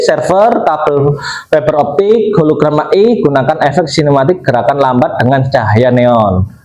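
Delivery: ordinary talk, nothing marked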